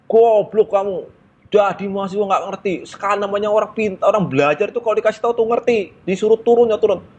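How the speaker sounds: angry conductor. Retold or imitated. Conductor scolding the students who did not get off, high angry rant. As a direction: berate the students like an enraged conductor. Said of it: "Gua makin kesel kan".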